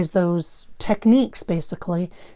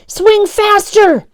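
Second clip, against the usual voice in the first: high, loud, slow drawn-out cry; hysterical warning; 13 semitones higher, 9 dB louder, much slower than usual. Acted. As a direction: scream at your friend to hurry. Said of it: "Daniel hysterically warned Phil".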